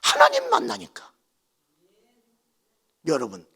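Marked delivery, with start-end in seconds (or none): 0.0-1.1 loud, high declaration on 하나님; preaching cry. Acted. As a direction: proclaim it to the congregation. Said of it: "climactic pulpit declaration". (하나님을 크게 외치며)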